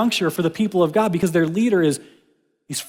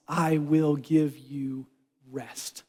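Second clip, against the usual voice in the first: slow, soft promise of rest; quietly reassuring, each word slow; tender promise; 3 semitones lower, 7 dB quieter, much slower than usual. Pretend. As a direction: hush it like comfort. Jesus's promise repeated.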